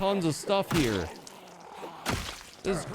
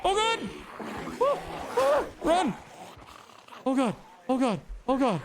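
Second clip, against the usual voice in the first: high panicked babbling; panicking, voice shooting up high; terrified gibbering; 8 semitones higher than usual. Real, not acted. Chased by zombies in game.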